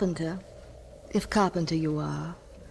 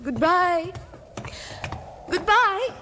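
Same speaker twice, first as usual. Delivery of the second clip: calling out; 13 semitones higher than usual. Acted. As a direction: shout a farewell to someone walking away. Calling after departing nephew.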